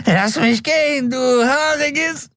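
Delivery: high, strained shriek of a dangling man; desperate thug. A narrator's reading. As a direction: scream to be let go. Dangling thug screams at Keru.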